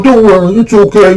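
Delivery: hammed-up there-there comforting; mock consoling. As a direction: coddle them like a pitiful child. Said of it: "we're patting you on the back".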